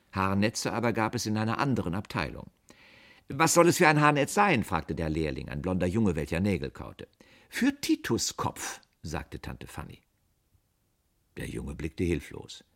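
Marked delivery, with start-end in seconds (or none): 3.3-4.6 put-on high, loud boyish question; young shop apprentice. A narrator's reading. Apprentice asking the customer, high and loud. 7.5-8.8 very high, slow, drawn-out reply; haughty answer. A narrator's reading. Aunt instructing the apprentice, haughty and slow.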